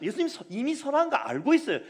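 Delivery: normal talking voice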